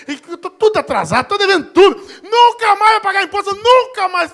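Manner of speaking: high, loud, hammed-up cry of relief; overjoyed outburst. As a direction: build to a shout as a debtor freed from taxes. Acted debtor overjoyed at tax exemption.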